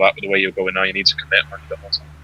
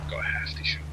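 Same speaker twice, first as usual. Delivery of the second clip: whispering; 13 dB quieter than usual. Real, not acted. Quiet callout mid-round.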